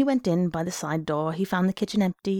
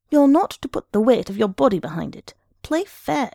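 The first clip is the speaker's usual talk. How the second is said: louder, higher, aggrieved protest; indignant accusation; 5 semitones higher, 6 dB louder than usual. A narrator's reading. Beaten arm-wrestler accusing his opponent, indignant. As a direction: protest like a sore loser.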